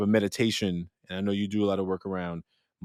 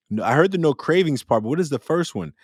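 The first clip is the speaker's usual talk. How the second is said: ordinary delivery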